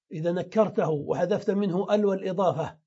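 ordinary talk

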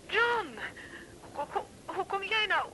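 emotional relief. Acted. Wife hears husband's voice, emotional.

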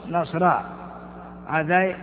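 ordinary talk

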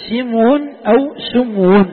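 drawn out, slow and loud; slow contrasting vowels. Shown as a demonstration. Teacher spelling out both forms, slow and loud. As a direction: sound out both vowelings slowly.

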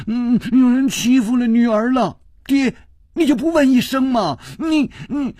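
high, whining tearful complaint; tearful plea. A narrator's reading. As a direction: whimper to daddy. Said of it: "带着哭音说". (女儿带着哭音向父亲告状)

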